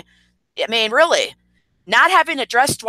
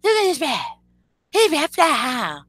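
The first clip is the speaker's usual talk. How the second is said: mock her gushing in a high, small voice; imitating hype person; 7 semitones higher than usual. Streamer mimics hype person praising his rap, high mocking voice. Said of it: "pretending that you don't know him, saying".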